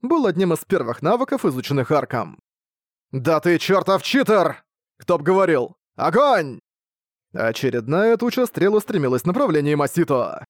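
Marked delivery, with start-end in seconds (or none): 3.1-6.6 shouted taunts. A narrator's reading. Boss and Ark trading shouts.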